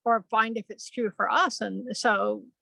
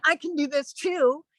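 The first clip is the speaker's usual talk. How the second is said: lightly voiced child, higher; a hint of an eager kid; little kid; 5 semitones higher than usual. Twelve-year-old pulling out a little sword, mildly childlike. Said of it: "like a little kid would".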